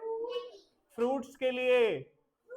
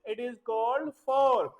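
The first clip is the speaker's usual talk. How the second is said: very slow, word by word; slow teaching delivery; much slower than usual. Shown as a demonstration. Teacher naming the fork for kids, very slow. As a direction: stretch each word for the class. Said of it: "बेटा ये कांटेदार जो चम्मच".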